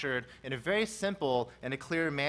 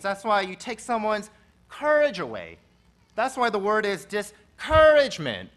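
louder, emphatic pulpit delivery; impassioned sermon voice; forceful preaching; 3 semitones higher, 6 dB louder than usual. Acted. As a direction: drive each point home to the congregation.

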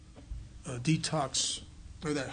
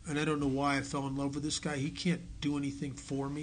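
ordinary talk, nothing marked

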